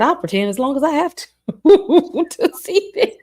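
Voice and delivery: high and fast, breaking into laughter; laughing character. Retold or imitated. Voicing the character to co-hosts, laughing high. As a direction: cackle at the idea. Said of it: "he said".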